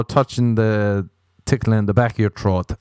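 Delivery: ordinary talk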